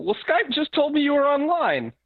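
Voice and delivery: high, sweet mock mother voice on the phone; imitating mom. Son voicing his mother on Skype.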